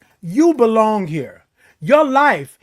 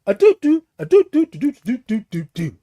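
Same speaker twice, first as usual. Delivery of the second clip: keypad tones; 2 semitones higher than usual. Retold or imitated. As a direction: mouth keypad noises.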